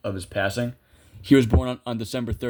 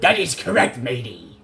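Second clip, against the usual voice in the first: put-on pirate accent; 3 dB louder than usual. Player joking as a pirate after a right answer. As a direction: play the pirate captain for fun. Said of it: "Just wanted to, like, uh, lift the".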